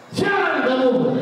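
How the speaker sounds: high, slow angry call; angry shout. Acted. Angry boy shouts at the unseen voice. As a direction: demand it angrily across the valley. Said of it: "Mulai marah dia".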